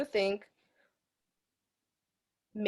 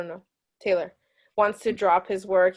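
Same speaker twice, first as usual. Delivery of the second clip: ordinary talk, nothing marked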